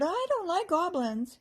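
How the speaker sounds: high squeaky voice of a timid squirrel; squeaky little squirrel. A narrator's reading. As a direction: squeak it like a frightened little animal. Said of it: "he squeaked".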